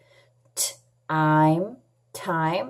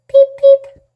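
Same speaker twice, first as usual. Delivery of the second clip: high, loud little chick peeping; chick peeps; 13 dB louder than usual. Pretend. Teacher voicing hungry chicks for young readers, high peeping. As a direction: squeak it like a hungry baby chick. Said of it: "The chicks".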